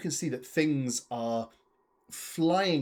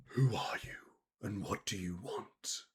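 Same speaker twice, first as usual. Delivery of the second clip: hushed, whispery menace; chilling voice; 5 semitones lower, 10 dB quieter than usual. Acted. Unseen being speaking into four minds, chilling whisper. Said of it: "you hear a chilling voice".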